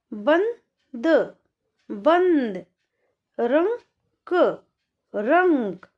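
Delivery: each word drawn out slowly, then said again normally; slow then normal reading. Shown as a demonstration. Teacher reading word pairs slowly, then normally. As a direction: stretch the word, then repeat it plainly. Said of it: "तो कैसे पढ़ेंगे?".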